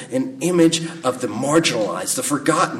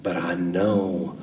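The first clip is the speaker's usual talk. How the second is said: low, slow, bad Southern accent; halting movie-character accent, low and badly faked; Forrest Gump; 7 semitones lower, 7 dB quieter, slower than usual. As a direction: do a terrible Gump accent, drawn out and softer. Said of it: "that was the worst accent ever".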